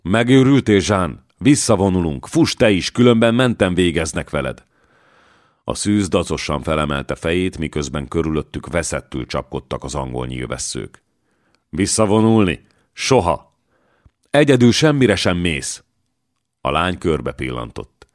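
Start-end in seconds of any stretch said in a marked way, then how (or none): 0.0-4.6 high, loud urgent call; frantic battlefield shout; shouting warning. Acted. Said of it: "imígyen kiáltott Reá". 11.7-15.8 defiant refusal. Acted. Joan defies retreat order.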